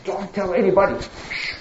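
very soft, secretive shushing; stealthy, breath-light warning; sneaky hushing. Acted. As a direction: hush them as if sneaking about at night. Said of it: "he will sneak at night".

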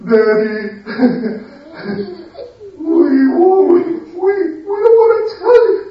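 very high hammed-up sobbing; crying without tears. Sons faking tears to Daddy at night, high mock sobbing. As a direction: pretend to weep, whining and pleading. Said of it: "Crying without tears".

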